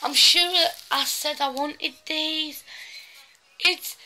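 high, slow gushing over cuteness; excited squeal. Real, not acted. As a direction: squeal at something adorable. Unboxing a cute gift.

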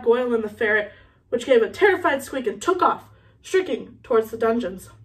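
ordinary delivery

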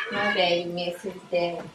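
ordinary delivery

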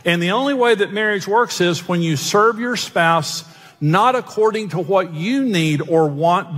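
normal talking voice

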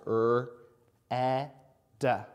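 segmenting each sound slowly. Shown as a demonstration. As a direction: sound out each letter separately. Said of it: "Do you hear all three sounds?".

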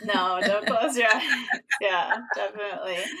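talking through real laughter; laughing. Real, not acted. Host laughs along with guest's joke about closing eyes, laughing. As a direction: agree while laughing, words breaking into laughs.

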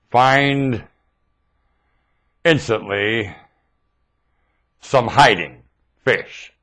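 "Find... instantly... some hiding... Fish" with long pauses between words, soft; spelled out slowly. Shown as a demonstration. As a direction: work the acronym out word by word. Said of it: "It's an acronym!".

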